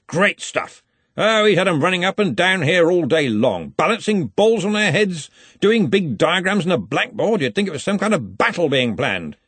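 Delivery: high, loud, enthusiastic telling; hearty old boss in full flow; jovial Arch-Chancellor. A narrator's reading. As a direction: relish it with booming joviality.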